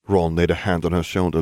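plain reading voice

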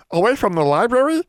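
high, anxious elf voice; tremulous. A narrator's reading. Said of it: "Bran said tremulously".

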